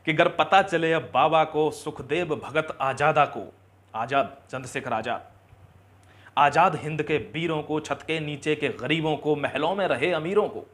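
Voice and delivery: rousing, emphatic verse with weighted line endings; stirring recited verse; patriotic poem recitation. Acted. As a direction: declaim it to the nation.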